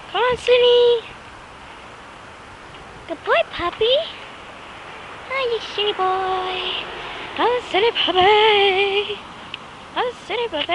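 sing-song voice for a puppy; puppy talk. Real, not acted. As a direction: coo at your dog in a high voice. Talking to the puppy.

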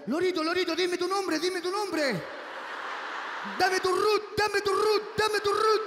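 high, loud coaxing voice building to a shout; neighbour coaxing parrot. Acted. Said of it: "una vecina grabando, pues le decía". (vecina que graba al loro, voz aguda que sube a gritos)

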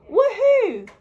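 very high, loud whoop; a joyful high cheer. Acted. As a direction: whoop for joy. Happy cheer after fair share.

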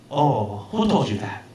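imitated boss, Chinese accent; Big Chen. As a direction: ask in a lightly mimicked Chinese accent. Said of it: "I'm just imitating his actual voice".

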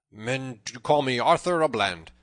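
loud, bold self-introduction. A narrator's reading. Tanner gives Robin his name, boldly. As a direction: speak up boldly and firmly. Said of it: "spoke up the tanner boldly".

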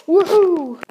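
loud, high whoop of joy; excited cheer. Pretend. Bodyguard cheering at the promised fun, loud whoop.

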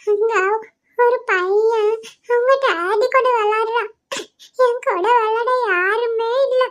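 put-on slow, high, tearful child's whine; crying. Child with no daddy to play with tells mother, crying. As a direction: drag the words out through gulping sobs. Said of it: "ஏன் இப்படி அழுகுறா?".

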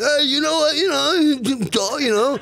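high, goofy excited fan; dopey fan voice. Acted. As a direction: brag like a dim fan. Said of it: "my dopey fan voice".

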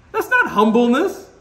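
ordinary delivery

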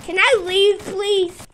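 ordinary delivery

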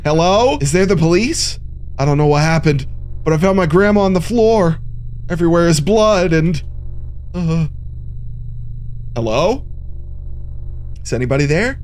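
a hint of a panicked caller; lightly acted frantic call to police; half-hearted distressed caller; mock emergency call. Calling police about grandma.

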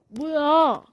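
ordinary talk, nothing marked

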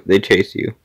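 normal talking voice

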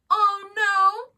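high, loud teasing oh no; mock dismay. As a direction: fake alarm, winking.

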